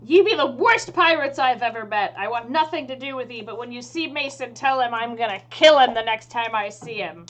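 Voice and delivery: loud pirate threat, bursts of force; in-character pirate telling-off; scornful pirate captain. Acted. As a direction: dress them down like an angry pirate. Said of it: "she looks at you and she says".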